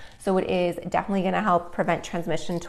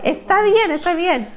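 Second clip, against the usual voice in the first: calm the little one down; 11 semitones higher, 4 dB louder than usual. Real, not acted. Soothing her little boy.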